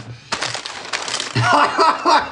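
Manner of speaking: high, breathy laugh; helpless high giggling; real laughing fit. Real, not acted. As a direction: dissolve into laughter.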